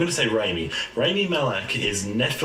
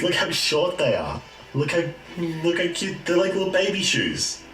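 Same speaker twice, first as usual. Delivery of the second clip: cooing; 6 semitones higher than usual. Real, not acted. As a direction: coo over something adorable. Cooing over tiny cow shoes.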